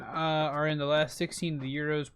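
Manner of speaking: usual speaking voice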